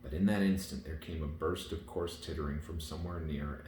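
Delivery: ordinary delivery, nothing marked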